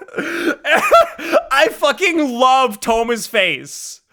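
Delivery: squeal-laugh through the words, slow and high; laughing hard. Real, not acted. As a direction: crack up mid-sentence.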